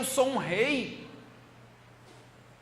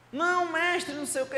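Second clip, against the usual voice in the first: pleading king; 8 semitones higher than usual. Acted. King begs fakir to stay.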